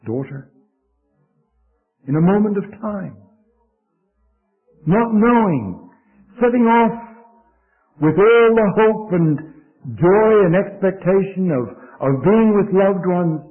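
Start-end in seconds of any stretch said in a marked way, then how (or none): 2.1-13.4 raised, higher pulpit voice; impassioned preaching. Real, not acted. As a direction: stretch the phrases with solemn urgency. Preaches on a sudden tragedy.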